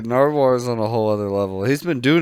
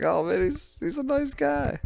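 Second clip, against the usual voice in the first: mock sweet; 7 dB quieter than usual. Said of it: "he's like".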